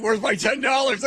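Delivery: hammed-up grab for the cash; mock demanding shout. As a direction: Jim asking to be paid. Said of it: "And he's like".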